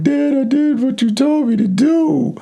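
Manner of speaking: high-pitched mock crying child; tearful daughter. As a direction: wail it like a daughter pleading with dad. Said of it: "crying, and she's like".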